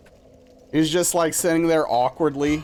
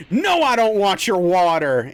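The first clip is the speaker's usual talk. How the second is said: louder mock snap at the helper; imitating an angry refusal; 4 semitones higher, 5 dB louder than usual. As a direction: throw the refusal back like a sore loser. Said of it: "you hear him say".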